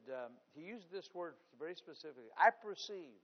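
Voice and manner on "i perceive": sudden loud proclamation; pastor's bold declaration. Retold or imitated. Pastor boldly declaring to the waitress, loud. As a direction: declare it loudly, like a prophet.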